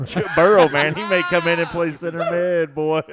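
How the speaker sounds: loud, high hyped-up shout; hammed-up sports hype, laughing; mock hype. Hyping Joe Burrow as a soccer midfielder, loud mock hype.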